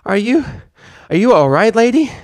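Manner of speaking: high, loud stammering filly; young filly voice, shaken; Apple Bloom stammering. Acted. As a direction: stammer it out, worried and breathless. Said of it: "Apple Bloom stammered".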